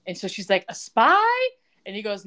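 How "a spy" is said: high, loud rising question; mock incredulous. Woman reacting to the job offer, mock incredulity. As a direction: squeal the question in put-on disbelief. Retold shocked question in recap.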